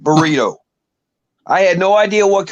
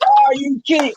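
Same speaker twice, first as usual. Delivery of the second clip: shocked; 10 semitones higher than usual. Retold or imitated. Retold shock at wife's news.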